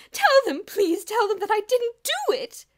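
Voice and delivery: very high, loud desperate begging; frantic plea. A narrator's reading. Miss Scrimmage pleading with Mister Sturgeon, shrill. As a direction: beg him to vouch for you.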